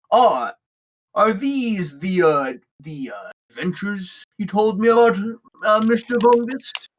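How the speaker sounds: a hint of a friendly, hesitant captain; lightly voiced orc captain. Orc captain greeting new adventurers, lightly voiced curiosity. Game master plays Downton the orc.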